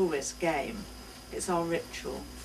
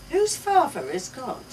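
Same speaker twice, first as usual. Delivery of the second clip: lightly voiced child; 7 semitones higher, 6 dB louder than usual. A narrator's reading. Child asking.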